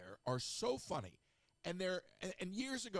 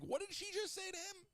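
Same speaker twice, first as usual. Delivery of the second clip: a much higher voice, astonished; astonished disbelief. Retold or imitated. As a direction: squeak it out in shock. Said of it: "and I'm like".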